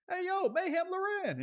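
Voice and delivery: high, bright hail across the parking lot; calling out. Retold or imitated. Calling out to Mayhem Loren walking by, high hail. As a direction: call his name to catch his attention.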